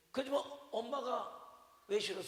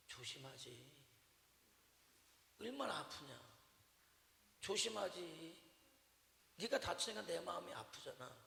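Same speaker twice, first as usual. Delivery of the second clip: tender mother; 4 semitones lower, 8 dB quieter than usual. Retold or imitated. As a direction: say it as a mother's hidden love. Mother's words reinterpreted tenderly. (사랑을 담아 부드럽게)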